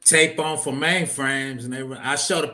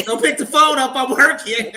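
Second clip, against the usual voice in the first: loud, high-pitched retold holler; screaming at mama; 11 semitones higher, 7 dB louder than usual. Son guarding the shared phone line, screaming. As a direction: yell it, laughter close behind. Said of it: "I'd be screaming".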